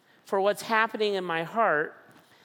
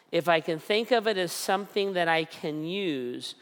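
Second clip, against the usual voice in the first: ordinary talk, nothing marked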